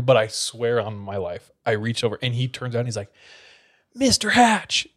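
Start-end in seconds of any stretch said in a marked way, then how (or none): 3.9-4.9 high, sharp call of a name; a teacher snapping at a pupil; angry teacher. Retold or imitated. Teacher snaps at student borrowing pencil, sharp high call.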